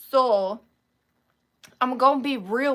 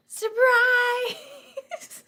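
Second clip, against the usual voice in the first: high, loud, drawn-out cheer; surprise shout; 11 semitones higher, 6 dB louder than usual. Pretend. Streamer springing a pajama party, gleeful shout.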